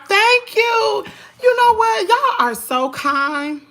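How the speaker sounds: high, loud, gushing thanks; excited thanks. Real, not acted. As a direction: beam at the viewers. Thanking new subscribers.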